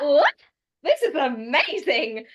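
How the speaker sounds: loud, high burst of delight; a gasp of thrilled disbelief; amazed surprise. Retold or imitated. Guest recalls hearing a Scottish Six cast, loud and amazed. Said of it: "And I was like".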